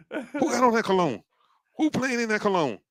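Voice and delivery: high-pitched mock interrogation; suspicious elder. Elder sniffs out a boy's borrowed cologne, high mock suspicion. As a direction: play an elder demanding to know, high.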